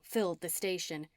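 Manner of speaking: ordinary delivery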